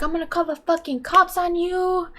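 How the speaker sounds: drawn from a story, high-voiced warning; retold threat. Acts out mother threatening attacker.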